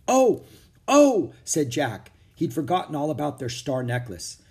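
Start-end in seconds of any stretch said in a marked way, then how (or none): 0.1-1.3 high, loud startled oh; sudden realization. Acted. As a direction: gasp as it hits you. Jack suddenly remembers necklace.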